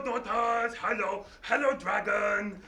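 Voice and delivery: plain reading voice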